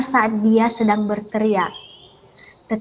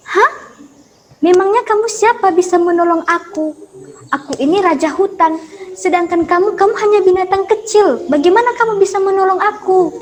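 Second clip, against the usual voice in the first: higher, scornful boasting lion; mock the tiny mouse as king of the jungle; proud lion sneers at the mouse; arrogant lion; 7 semitones higher than usual. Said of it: "dengan sombongnya si singa pun berkata".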